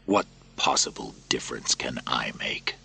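doubt yourself quietly; despairing soldier. Acted. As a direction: Maximus hopeless. Narrator voices Maximus.

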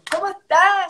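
high, loud happy hello; excited greeting. Real, not acted. Host welcoming Rodolfo on the live, high and loud. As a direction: greet the guest with delight.

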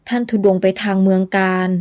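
plain reading voice

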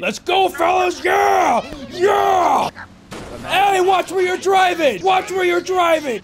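slow, loud, hammed-up war cries; rallying battle shout. Player rallying squad then yelling at the driver, loud hammy shouting. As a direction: bellow at the squad.

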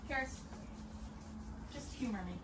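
ordinary delivery

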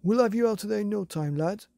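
loud, fast, high reassuring shout. A narrator's reading. The dog's owner shouts down to Billy in the quarry. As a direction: call down cheerfully to the boy. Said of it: "shouted the man".

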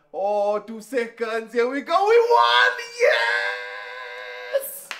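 drawn-out countdown rising to a high scream; building to victory scream. Real, not acted. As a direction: climb from tense anticipation into full screaming triumph. Final seconds, then victory.

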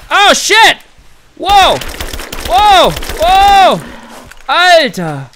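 loud shocked yells at a sudden animal; startled shout. Real, not acted. Gamer startled by a beast, shouting loud in shock. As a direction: yell in real fright.

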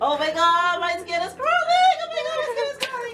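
very high, loud shriek; squealing skin-crawling panic. Real, not acted. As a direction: screech it in horror. Disgust and fear of spider.

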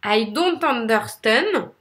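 slow English in a thick French accent; hammed-up French accent with French R's. Shown as a demonstration. Coach showing what marks you as French, thick French accent. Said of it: "c'est le bon accent français avec les bons R".

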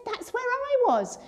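put-on light up, like an old airman recognising his base; animated. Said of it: "he started becoming quite animated".

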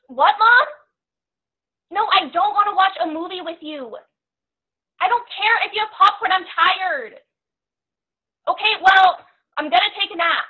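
put-on high, loud irritated teen; exasperated yelling. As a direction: call back loudly to mom, fed up. Acted teen snapping at her mom.

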